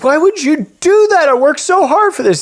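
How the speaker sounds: high, loud yell of protest; anguished son. Retold or imitated. Son whose hard-earned money is burning, anguished yell. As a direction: act out a son crying out at the injustice.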